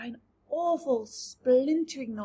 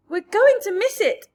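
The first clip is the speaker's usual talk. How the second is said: high, loud excited shout; hopeful shout; 9 semitones higher, 12 dB louder than usual. A narrator's reading. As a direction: shout it out in hope. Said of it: "shouted the Ladybird".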